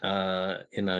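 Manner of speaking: ordinary delivery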